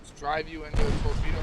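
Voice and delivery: loud exasperated complaint; frustrated. Real, not acted. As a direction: vent at the blunder, loud. Frustrated after hitting torpedo.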